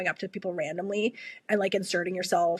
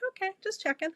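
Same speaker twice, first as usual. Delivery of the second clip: sheepish; 11 semitones higher, 5 dB quieter, faster than usual. Retold or imitated. Sheepish reply to friend.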